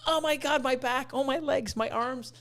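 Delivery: high, whimpery mock moaning; aching complaint. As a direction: moan through each sore body part. Imitates waking up aching all over.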